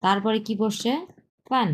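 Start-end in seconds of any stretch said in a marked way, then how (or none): 1.5-1.7 ফান with a sharp falling pitch; fourth tone. Shown as a demonstration. Teacher models the second syllable, falling fourth tone on ফান. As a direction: drop the pitch through ফান. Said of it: "চার number tone".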